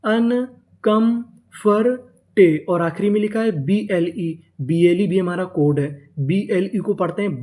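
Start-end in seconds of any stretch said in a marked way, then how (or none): 0.0-2.0 अनकम्फर्टे slowly split as अन, कम, फर, टे; syllable by syllable. Shown as a demonstration. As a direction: build अनकम्फर्टे piece by piece with pauses. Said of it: "तो पूरी meaning". (अनकम्फर्टे को रुक-रुककर, टुकड़ों में)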